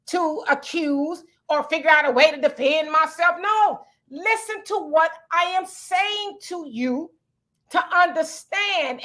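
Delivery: normal talking voice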